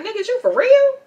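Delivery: can't believe what she hears; shocked disbelief. Retold or imitated. Ani reacts to fiance's complaint, shrill shock. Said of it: "She like".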